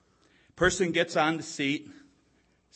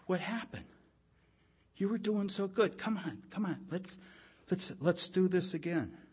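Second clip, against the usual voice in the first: softer, coaxing parent voice; gentle encouragement; 3 semitones higher, 5 dB quieter than usual. Retold or imitated. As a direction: lift them back up kindly. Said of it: "walking up to 'em and going".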